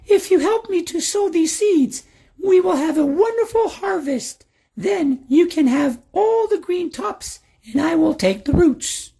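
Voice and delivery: put-on very high, eager old man voice; sly old man. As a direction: pitch it up and sell the deal. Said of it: "The old man said quickly".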